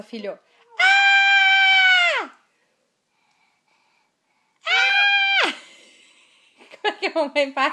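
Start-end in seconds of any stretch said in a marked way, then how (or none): 0.8-5.5 high piercing shriek; nervous mom scream. Shown as a demonstration. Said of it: "como é que a mamãe faz quando a mamãe fica nervosa".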